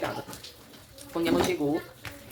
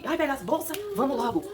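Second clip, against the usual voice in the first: high-pitched pretend panic; hammed-up frantic rush; mock panic; 9 semitones higher than usual. A mother imagining how a desperate woman in labour sounds, mock panic. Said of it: "Eu não fiquei tão desesperada".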